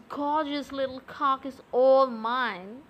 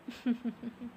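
soft laugh; 7 dB quieter than usual. Acted. Teasing him for begging.

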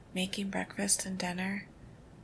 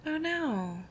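sympathetic dismay; 8 semitones higher, 4 dB louder than usual. Acted. Hears partner's bad news.

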